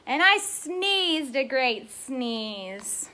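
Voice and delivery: very slow, drawn out; savoring the big moment slowly; dramatic slow telling. Acted. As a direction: stretch every word of the big sneeze. Dramatic sneeze line, very slow.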